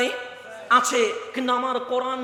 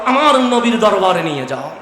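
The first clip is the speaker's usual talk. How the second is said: loud, rousing sermon chant; preaching at full force; 6 dB louder than usual. Acted. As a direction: preach it out to the crowd. (জোরালো ওয়াজের সুর)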